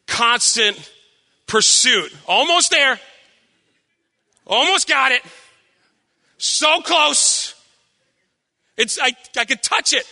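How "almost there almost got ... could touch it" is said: higher-pitched, acted strain; straining to reach. Preacher miming constant pursuit of happiness, straining to reach. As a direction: reach for something just beyond your grasp.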